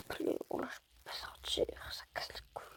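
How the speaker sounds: muttered whisper. Real, not acted. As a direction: grumble under breath. Grumbling under breath. (chuchotement agacé)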